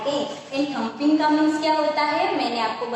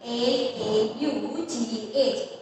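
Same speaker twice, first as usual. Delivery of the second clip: slow spelling; slower than usual. Shown as a demonstration. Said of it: "next one is".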